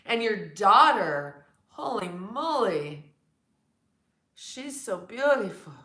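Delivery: a hint of a smitten man; admiring man. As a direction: mimic a guy gushing, stretched out. Imitating men admiring her daughter.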